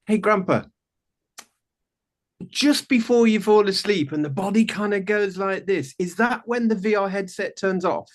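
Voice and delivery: lightly voiced little boy with a question; grandson asking. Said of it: "and he said".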